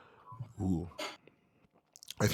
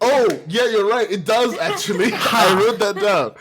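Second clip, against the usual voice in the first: laughing; 8 semitones higher, 9 dB louder than usual. Real, not acted. Laughs, realises quest counts.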